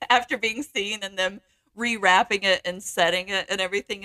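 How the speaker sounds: normal talking voice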